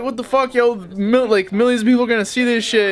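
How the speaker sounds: high, fast, shocked disbelief; shocked outburst. Retold or imitated. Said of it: "I was like".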